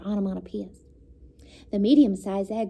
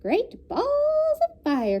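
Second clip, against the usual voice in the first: much higher, slow goose cry; goose exclaiming; 3 dB louder, slower than usual. A narrator's reading. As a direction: drawl it big like a brassy goose. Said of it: "cried the goose".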